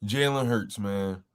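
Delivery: ordinary talk, nothing marked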